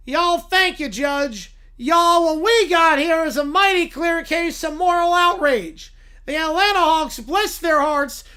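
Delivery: loud, pompous Southern lawyer voice. Acted. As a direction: preach moral outrage to the judge like a Southern showman. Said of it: "Southern blue-haired lawyer".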